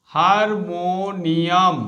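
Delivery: Harmonium drawn out syllable by syllable; very slow. Shown as a demonstration. Teacher to students, very slow harmonium. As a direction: stretch out harmonium for the spelling. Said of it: "বানানটা মনে রাখবে".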